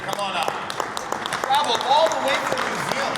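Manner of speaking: loud, fast, high game show call; booming host welcoming a contestant from New Zealand. Pretend. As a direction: hype him up onto the stage like a TV host. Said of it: "a Price is Right style presentation".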